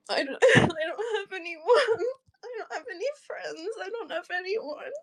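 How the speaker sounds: real tearful despair; crying. Real, not acted. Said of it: "I'm just crying".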